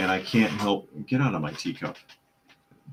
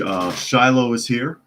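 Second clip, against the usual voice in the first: ordinary talk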